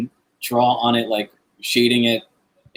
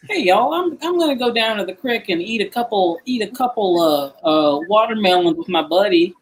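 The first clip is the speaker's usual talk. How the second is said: put-on Southern accent; 8 semitones higher than usual. Accentist showing off accent number one, high Southern accent. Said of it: "Southern accent is number one".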